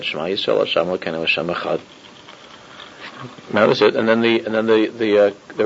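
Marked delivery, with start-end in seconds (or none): 0.0-1.8 quiet, lower and calm, unhurried prayer; condemned rabbi answering his captor with quiet calm; very calmly. Retold or imitated. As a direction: recite it with total calm, as if no gun were there. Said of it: "very calmly said".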